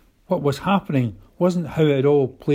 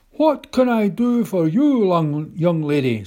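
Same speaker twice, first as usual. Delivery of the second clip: put-on high, refined old gentleman's voice; an old tramp who sounds surprisingly upper-class; posh tramp voice; 4 semitones higher than usual. A narrator's reading. Old tramp greets the girl on his bench, posh. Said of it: "His voice was unexpectedly posh".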